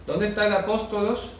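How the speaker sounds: a hint of a clueless traveller's query; traveller asking directions. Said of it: "si tú ibas a Grecia sin saber nada de Biblia y preguntabas".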